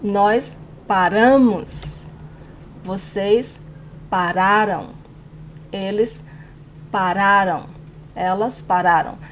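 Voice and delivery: paRAmos, paRAram, middle syllable strong; measured drill, endings of "paramos" and "pararam" left weak; stress second-to-last syllable. Shown as a demonstration. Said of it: "the stronger syllable is the second to last".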